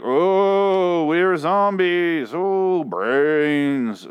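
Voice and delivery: high, slow, dopey zombie drawl; mock zombie moan. Mocking the show's zombies, a slow high zombie moan. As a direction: groan it out like a lazy TV zombie. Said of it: "the zombies that are moving like".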